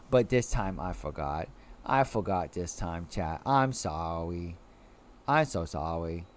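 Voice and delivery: low, soft, pouty apology; babyish apology. Pretend. Sheepish babyish apology to chat.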